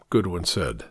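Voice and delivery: usual speaking voice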